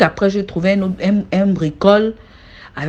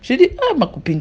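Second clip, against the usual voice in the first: ordinary talk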